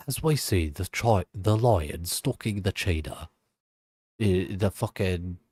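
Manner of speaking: lightly voiced hushed nature-documentary narrator, a touch slow; a hint of a posh British wildlife narrator; David Attenborough. Streamer mocks nature documentaries in a mild Attenborough voice. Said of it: "David Attenborough".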